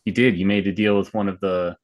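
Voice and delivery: normal talking voice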